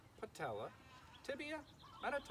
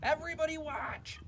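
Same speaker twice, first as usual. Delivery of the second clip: loud, high call for attention; cried out; 12 semitones higher, 7 dB louder than usual. Acted. Pig calls to his watching friends, loud and high. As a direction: shout it out proudly to everyone. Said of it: "he cried".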